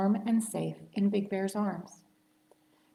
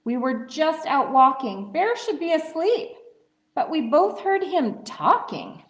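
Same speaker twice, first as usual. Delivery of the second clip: higher, louder cheery call; hare's greeting; 7 semitones higher, 5 dB louder than usual. Acted. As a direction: hail your friend through the door. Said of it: "says Hare".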